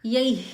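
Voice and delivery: यही with a clear, emphatic final "hi"; visarga as i. Shown as a demonstration. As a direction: say यही, the visarga after ai turning into "hi". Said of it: "तो इसका उच्चारण यही".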